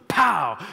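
mock pow. Pastor mimicking a lightning bolt with a sudden loud pow. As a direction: punch it like a bolt striking.

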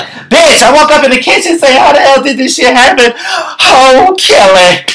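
high, loud, frantic put-on voice. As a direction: play her panicking on the phone. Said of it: "She's like".

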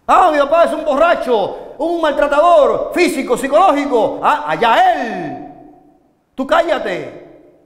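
put-on dismissive teenager. Preacher voicing a young person who dismisses his drunk father, dismissive. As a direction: brush it off as if it doesn't matter, slowing at the end. Youth shrugging off abusive father.

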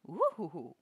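ordinary talk, nothing marked

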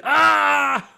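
high yelped cry of a wounded toy soldier; mock pained scream. Kid voicing a wounded toy: high yelp. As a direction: yelp it like a gutted action figure. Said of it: "He's like".

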